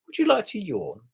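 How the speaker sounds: high-pitched imitation of a Chinese accent; imitated Chinese accent. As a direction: put on a Chinese-accented old lady's invitation. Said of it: "Chinesey kind of like accent".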